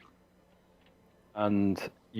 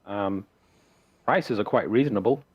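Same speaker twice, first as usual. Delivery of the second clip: lightly voiced farmer, higher at first; Bildrath; 6 semitones higher than usual. Farmer recommends trading post to travellers, lightly voiced sales patter. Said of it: "Says, uh".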